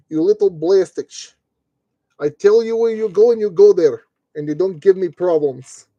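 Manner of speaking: playful mock-Russian scolding; mildly bossy, put-on Russian accent; slight Russian accent. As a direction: lay down the law in a light Russian accent. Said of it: "slightly Russian accent, but just go with it".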